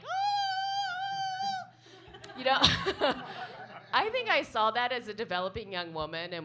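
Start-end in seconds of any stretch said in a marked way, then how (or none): 0.1-1.7 very high scream held long; long high mock scream; ecstatic scream. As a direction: scream up at the twister. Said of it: "looking up like".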